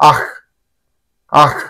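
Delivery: "ఆహ్" open-mouthed with a throaty kh ending; "ఆహ్" as if spitting it up; as if retching. Shown as a demonstration. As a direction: hack out each "ఆహ్" from the throat. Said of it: "కక్కినట్లు అనమాట".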